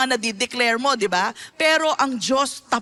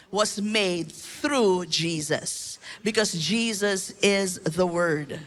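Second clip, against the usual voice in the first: solemn preaching; 6 semitones lower than usual. Real, not acted.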